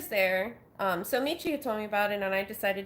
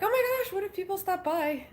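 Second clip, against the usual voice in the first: high, squeaky panicked gasp; mock panic; 10 semitones higher than usual. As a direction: act out a sudden nervous panic. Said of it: "but I'm like".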